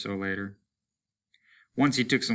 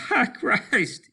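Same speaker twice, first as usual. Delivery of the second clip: laughing; 12 semitones higher, 4 dB louder than usual. Acted.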